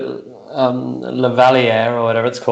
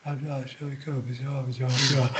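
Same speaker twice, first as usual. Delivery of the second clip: far softer murmur, almost nothing; barely audible actor; 13 dB quieter, slower than usual. Retold or imitated. Screen actor mumbling lines at a scene partner, far softer. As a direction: mumble the sures under your breath. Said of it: "they're going".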